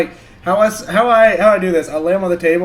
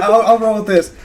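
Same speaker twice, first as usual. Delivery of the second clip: ordinary talk, nothing marked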